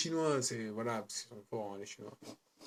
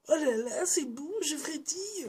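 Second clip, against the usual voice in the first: squeaky, much higher delighted gush; giddy young snake spirit; 14 semitones higher than usual. Retold or imitated. Vlogger mimics Green Snake discovering human life, squeaky and giddy. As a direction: play the wide-eyed snake thrilled by everything.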